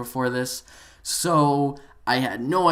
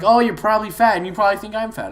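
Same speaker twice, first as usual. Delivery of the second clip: high, louder mocking jibe; mock taunting player; 6 semitones higher, 5 dB louder, faster than usual. Storyteller imitating the player's insults, high mock taunt. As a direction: imitate someone flinging petty insults.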